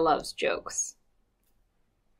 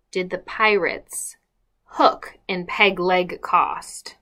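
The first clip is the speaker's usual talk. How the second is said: plain reading voice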